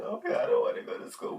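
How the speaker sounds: high squeaky retold whine; hammed-up high-pitched dread; mock traumatized. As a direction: whine it high like a frightened kid. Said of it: "we were traumatized from that moment on".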